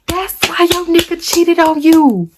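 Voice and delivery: high-pitched mocking imitation; rival's taunt. Teller mimics the girl taunting her, mocking. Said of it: "This girl proceeds to say".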